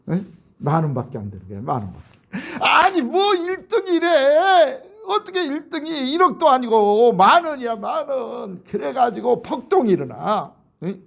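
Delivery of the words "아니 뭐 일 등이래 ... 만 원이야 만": outraged cry. Acted. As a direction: wail in disbelief at the prize. Winners outraged at tiny prize.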